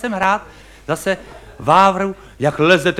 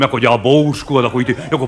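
usual speaking voice

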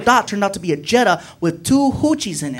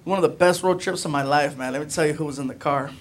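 normal talking voice

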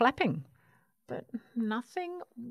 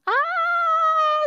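loud, high terrified "Ah!"; high scream; 8 dB louder than usual. A narrator's reading. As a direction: scream at a sudden monster. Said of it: "screamed Baby".

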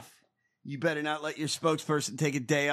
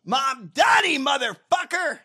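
high, loud shouted name; mock outraged yell; 10 semitones higher, 6 dB louder than usual. Host piling onto the mocked names, mock outraged yell.

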